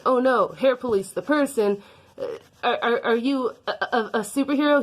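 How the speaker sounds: high, loud mock panic; mock alarm. She meets the villain Hair Police, in mock fright. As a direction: gasp out the villain name in fake fear.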